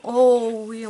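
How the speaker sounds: loud delighted burst; excited gasp. Real, not acted.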